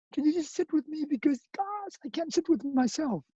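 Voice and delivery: begging God quietly for company; pleading prayer. Pretend. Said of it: "or I'm just gonna say".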